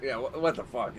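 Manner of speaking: usual speaking voice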